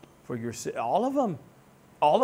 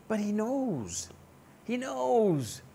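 ordinary talk

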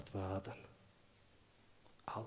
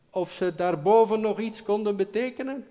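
higher, louder call upward through cupped hands; 9 semitones higher, 10 dB louder than usual. Acted. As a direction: shout up the mountain to the boss. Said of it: "Ze zetten hun handen als een toeter om hun mond". (roepend naar boven door handen als toeter)

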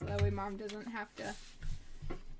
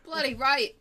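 louder, mock British "Bloody right"; British accent; 2 semitones higher, 7 dB louder than usual.

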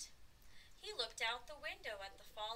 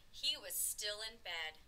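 ordinary delivery

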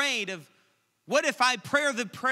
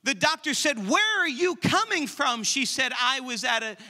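loud, high, driving sermon voice; loud fervent preacher, pushing every phrase; full-force preaching; 5 semitones higher, 4 dB louder than usual. Acted.

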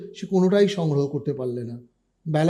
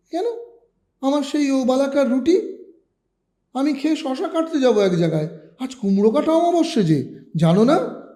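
put-on higher puzzled boy voice; astonished boy; 7 semitones higher than usual. A narrator's reading. Boy asking his mother in surprise, pitched higher. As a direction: ask in disbelief. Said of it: "বিস্মিত সুরে বললে".

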